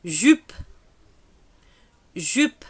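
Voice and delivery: "Jupe" said clearly as zhüp; model word. Shown as a demonstration. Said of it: "teacher models vocabulary word". ("Jupe" articulé)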